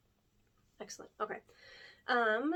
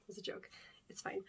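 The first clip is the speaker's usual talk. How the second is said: whispering; 10 dB quieter than usual. Real, not acted. Reassuring after whispered gossip.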